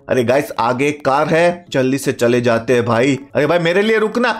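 ordinary talk, nothing marked

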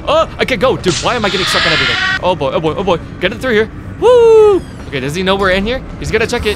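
frantic hiding. Real, not acted. Stuck, then hiding from monster.

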